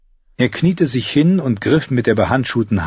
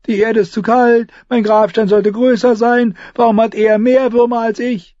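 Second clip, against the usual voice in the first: high, whiny gloomy voice; 12 semitones higher, 4 dB louder than usual. Acted. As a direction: moan it like a glum squire. Gloomy Ed complains.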